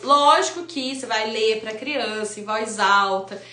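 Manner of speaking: ordinary talk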